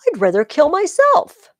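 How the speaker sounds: high, loud cry of horror; aghast outcry. Acted. Clitus to Brutus, crying out in horror. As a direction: throw the refusal back at him.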